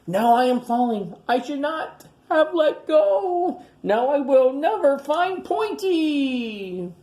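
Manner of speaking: a tiny dino wailing as he falls; panicked little voice. Acted. Tiny falling, despairing.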